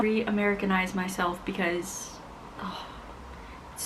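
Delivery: breathy whispered aside. Real, not acted. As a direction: whisper it under your breath.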